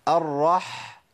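الرح ending on a long breathy throat ح; audible ح rasp. Shown as a demonstration. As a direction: hold the husky friction of ح at the end of الرح. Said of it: "ودي اسمها بحة الحاء".

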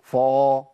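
فا said thick and heavy, like an emphatic. Shown as a demonstration. Teacher mocks people who thicken the f. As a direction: darken the فا into a thick vowel. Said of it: "إيه فا دي؟".

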